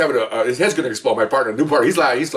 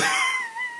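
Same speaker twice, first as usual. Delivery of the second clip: high wheezing laugh; helpless laughter; 8 dB quieter than usual. Real, not acted. Storyteller cracking up at his own breakup bit, helpless laughter.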